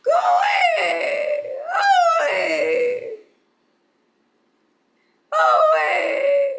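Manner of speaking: high, loud screeching bird cry; go-away bird call. Shown as a demonstration.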